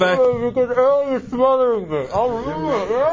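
muffled, as if through a wall. Real, not acted. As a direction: talk with your face smothered. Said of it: "why do you sound muffled".

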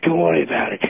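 winded, upset dismissal; out of breath. Real, not acted. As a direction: brush it off while panting. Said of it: "Why are you still out of breath?".

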